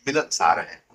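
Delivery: ordinary talk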